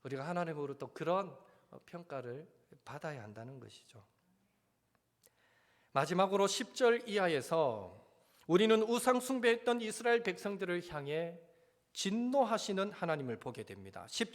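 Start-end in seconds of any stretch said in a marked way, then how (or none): none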